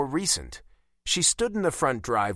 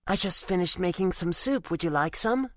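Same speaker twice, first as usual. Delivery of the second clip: put-on gentle older woman; 7 semitones higher than usual. Kind woman offers soup.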